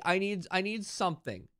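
ordinary delivery

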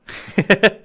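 laugh. Real, not acted. Laughs at own pun.

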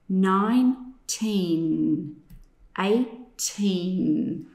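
nineteen, eighteen with long held final N; drawn-out N endings. Shown as a demonstration. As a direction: stretch each N ending slowly. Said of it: "listen how long I say that N sound".

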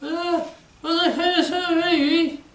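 put-on high childlike puppet voice. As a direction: voice the little puppet, pitched high. Puppet asks adult a question.

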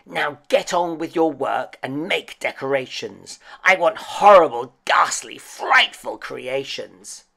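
commanding storybook witch, building in force; bossy wicked witch. A narrator's reading. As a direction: relish each gruesome adjective as a witch. Witch orders pumpkins about.

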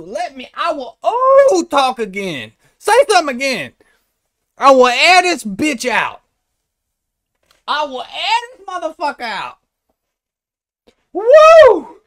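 high, loud hollering threats with long whoops; Pinky. Acted. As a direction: whoop and shout it in a put-on high voice. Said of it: "Like Pinky in fucking Friday Part Two".

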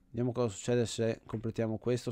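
ordinary talk

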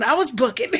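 words tipping into a laugh. Real, not acted. Woman telling viewers how she fled, laughing. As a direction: finish the line breaking up with laughter.

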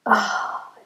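ordinary talk

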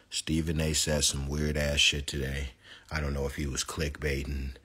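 ordinary talk

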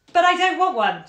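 high, loud strained smiling protest; desperate smile. A narrator's reading. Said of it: "smiling desperately".

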